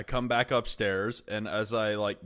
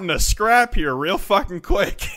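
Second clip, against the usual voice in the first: loud, high alarmed plea; urgently warning a friend; panicked warning; 7 semitones higher, 7 dB louder than usual. Retold or imitated.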